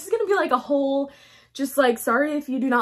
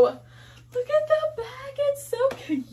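squeaky, much higher, slow; high cutesy voice; 13 semitones higher, 4 dB quieter, slower than usual. Real, not acted. Showing viewers the jeans' back, in a high cutesy coo.

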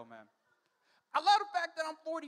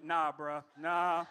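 knowing refusal; 9 semitones lower, much slower than usual. Retold or imitated. Refusing the drive-thru.

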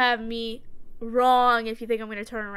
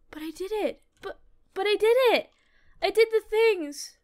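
whining protest; 7 semitones higher than usual. Real, not acted. Protests the game's result.

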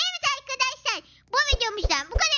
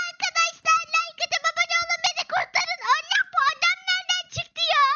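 being chased; 7 semitones higher than usual. Pretend. Being chased, begging viewers.

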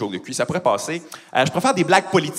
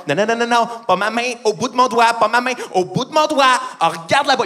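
raised, insistent correction; exasperated dog owner; 5 semitones higher than usual. Acted. Owner correcting a dog staring at the hand, exasperated. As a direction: insist with mounting mock frustration.

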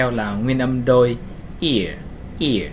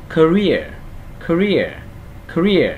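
"career" with REER higher and falling; stress on second syllable; 4 semitones higher, slower than usual. Shown as a demonstration. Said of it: "Trọng âm nằm tiết thứ hai". (nhấn cao âm tiết thứ hai của "career")

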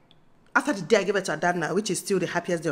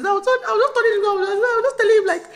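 high, fast, laugh-choked words; laughing while talking; 12 semitones higher, 4 dB louder, faster than usual. Real, not acted. Telling viewers about her husband, high laughing words. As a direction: giggle your way through it.

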